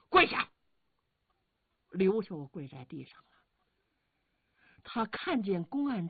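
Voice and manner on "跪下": short, loud command; loud shout. A narrator's reading. Guards order the prisoner to kneel, shouting loudly. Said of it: "高声喊嚷".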